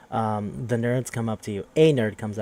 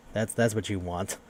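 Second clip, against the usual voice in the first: usual speaking voice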